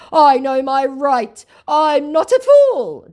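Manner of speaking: high, loud, slow indignant declaration; mock scammer.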